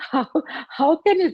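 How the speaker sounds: incredulous outburst; amazed. Real, not acted. Speaker reacting to the stranger's claim, amazed. Laughing disbelief at his claim.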